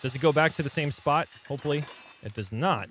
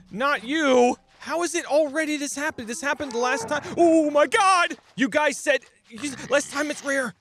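panicked high-pitched yelling; frantic shrieks while fleeing; being chased; 11 semitones higher, 4 dB louder than usual. Real, not acted. Monsters swarm the player.

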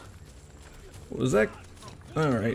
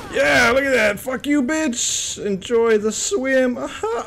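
high, loud, slowed triumphant taunt with a laugh; gloating taunt; 12 semitones higher, 3 dB louder, slower than usual. Real, not acted. Gamer taunting an enemy knocked into the water, gloating. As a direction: rub it in his face slowly.

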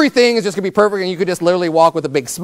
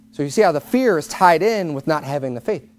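ordinary talk, nothing marked

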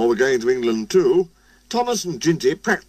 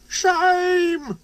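very high drawn-out squeak. A narrator's reading. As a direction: squeal it out like a little engine. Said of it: "squeaked Percy".

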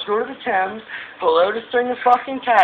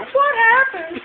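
leaps much higher in disbelief; mock surprise; 14 semitones higher, slower than usual. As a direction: yelp it up high, feigned shock. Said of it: "I was like".

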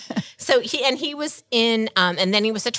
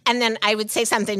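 ordinary delivery

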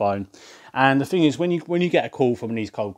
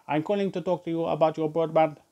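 higher-pitched mock telemarketer; young lady caller; 5 semitones higher than usual. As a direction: recite the script as a young woman caller. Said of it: "this young lady comes on the phone".

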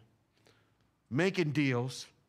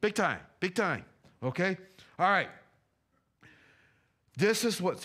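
ordinary talk

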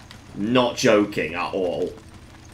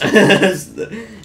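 high, loud burst of laughter; 11 semitones higher, 8 dB louder than usual. Real, not acted. Gamer joking about shooting his partner, loud laugh. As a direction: laugh out at your own joke.

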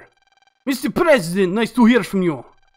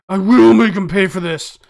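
loud, hot-headed vow; vengeful shout; 8 dB louder than usual. Acted. Lan vowing revenge on the enemy, loud and furious. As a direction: shout it like a wounded hero.

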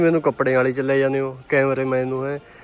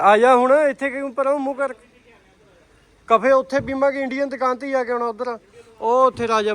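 calling out; 9 semitones higher than usual. Real, not acted. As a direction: call him over from a distance. Calling out to friend Bagga. (ਦੂਰੋਂ ਉੱਚੀ ਆਵਾਜ਼ ਵਿੱਚ ਬੁਲਾਉਣਾ)

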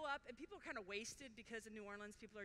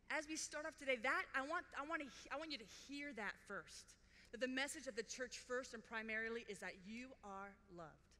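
loud earnest sermon push; emphatic pulpit delivery; preaching; 5 dB louder than usual. Real, not acted. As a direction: drive the message home to the hall. Preaching to workshop.

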